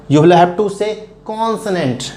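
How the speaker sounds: consonant said very slowly, each syllable spelled out; slow model pronunciation. Shown as a demonstration. Teacher to students, correcting consonant, slow. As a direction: stretch out consonant for the class. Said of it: "consonant is not a proper pronunciation".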